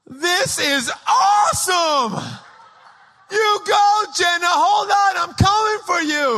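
put-on high, loud kid excitement; thrilled boy shouting. Young fireman cheering on his sister, excited shout. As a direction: holler it like an overjoyed little brother.

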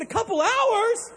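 high, slow, loud disbelief; can't believe what you just heard; incredulous shout. Retold or imitated. Captain hears rescue is hours away, incredulous. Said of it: "I said".

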